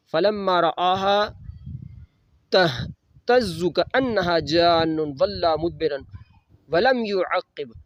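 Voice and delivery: very slow, drawn-out Quranic recitation. Acted. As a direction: stretch each word of the verse reverently. Quran recitation within the sermon. (بہت آہستہ قرآنی تلاوت)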